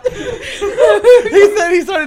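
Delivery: high, slow, laughing through it; wheezing with laughter. Real, not acted. Cracking up at friend's story.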